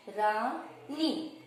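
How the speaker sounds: रानी very slowly, "raa-nee" in two long syllables; demonstrated whole word. Shown as a demonstration. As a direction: sound out रानी for beginners. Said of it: "उसका उच्चारण क्या होता है". (रानी को धीरे-धीरे खींचकर बोलें)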